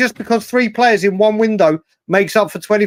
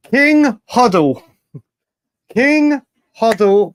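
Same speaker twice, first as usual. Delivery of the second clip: high, slow drawn-out hello; excited greeting; 4 semitones higher, slower than usual. Real, not acted. Greeting a friend in chat.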